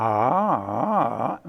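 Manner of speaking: one steady ah sung at changing pitches; pitch going up and down. Shown as a demonstration. Showing the class a vowel that stays the same as pitch moves. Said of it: "My voice is going up and down".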